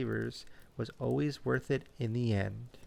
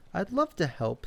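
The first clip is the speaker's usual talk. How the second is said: squirrel voice; 6 semitones higher, 3 dB louder than usual. A narrator's reading. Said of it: "said Bella".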